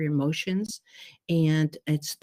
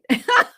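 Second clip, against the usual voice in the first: loud high laugh; 9 dB louder than usual. Real, not acted. Laughing at own nose joke.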